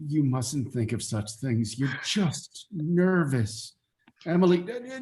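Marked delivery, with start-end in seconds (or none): none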